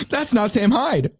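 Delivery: high, drawn-out mocking whine; put-on high-pitched protest; mock complaining. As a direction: imitate an upset viewer in a high, slow voice. Mocks complaining chat comments, escalating.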